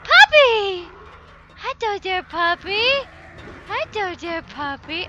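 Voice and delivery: high, loud sing-song pet talk; mock puppy greeting. Gamer greeting a monster as a puppy.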